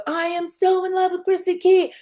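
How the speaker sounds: high, gushing confession; gushing fan. Retold or imitated. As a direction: gush about your favorite comedian. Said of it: "And I go".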